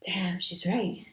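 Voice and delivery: slow, hushed whisper; whispered realization. Retold or imitated. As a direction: breathe it out as a quiet realization. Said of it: "I was like".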